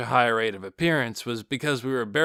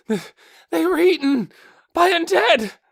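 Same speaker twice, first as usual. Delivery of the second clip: high, loud, stammering terror; terrified stammer; 10 dB louder than usual. A narrator's reading. Survivor tells the guards about the attack, terrified stammer. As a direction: blurt it out in terror. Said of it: "terror written all over his face".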